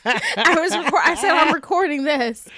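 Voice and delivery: laughing loudly through it. Retold or imitated. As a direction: giggle while saying it.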